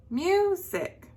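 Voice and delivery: music with MU high, SIC falling; up, then down. Shown as a demonstration. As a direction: pitch MU way up and drop SIC. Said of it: "it goes up and then it goes down".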